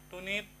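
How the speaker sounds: high two-note beep, tunit; imitated beep. Showing viewers the only sound it makes, a high beep. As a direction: mimic the headset's little pairing chime. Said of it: "cuman bunyi gitu doang".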